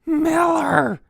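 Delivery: high, loud drawn-out howl. A narrator's reading. Counselor howling for hidden camper. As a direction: howl the name. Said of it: "he howled".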